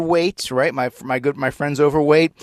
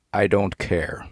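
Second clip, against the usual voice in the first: deadpan dismissal; 4 semitones lower, 4 dB quieter, slower than usual. Acted. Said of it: "my official response ... is".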